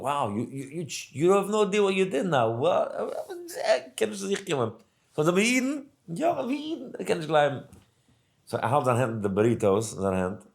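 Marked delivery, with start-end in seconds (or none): 0.0-2.9 retold excited reaction. He tells the man what happened. 2.9-7.6 high, startled voice of the man, pitched up; high, worried man. Retold or imitated. Man asks if he can still eat.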